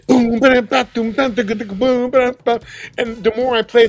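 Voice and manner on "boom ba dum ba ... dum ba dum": loud, higher mouth drumming; vocal drum beat. Retold or imitated. Drummer playing a beat in the studio, vocal drumming. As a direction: pound out a drum pattern by voice. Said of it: "I'm sitting there just".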